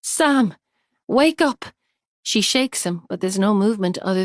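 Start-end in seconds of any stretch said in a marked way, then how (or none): 0.0-1.7 loud, high call to wake him; frantic call into his ear; urgent call. A narrator's reading. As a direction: shout him awake. Shaking unconscious husband awake.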